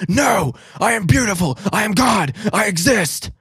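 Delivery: shouted high and loud, grandiose; mock manic shout. Imitating the tripper answering himself, hammed-up shout. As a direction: proclaim yourself divine at the top of your voice. Tripping man's defiant reply.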